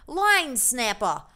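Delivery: botched British accent sliding into Australian; attempted British accent. Shown as a demonstration. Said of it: "I think it was Australian".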